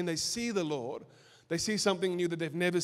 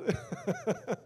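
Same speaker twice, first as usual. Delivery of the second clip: short laugh; 4 semitones higher, 5 dB quieter than usual. Real, not acted. Laughs at own story.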